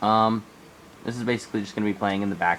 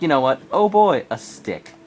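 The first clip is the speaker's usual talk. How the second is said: mock excitement; 4 semitones higher, 5 dB louder than usual. Friend voiced finding a stick.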